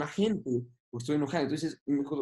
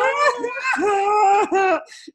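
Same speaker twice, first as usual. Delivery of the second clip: loud burst of laughter; 8 dB louder than usual. Real, not acted.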